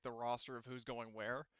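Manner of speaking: ordinary talk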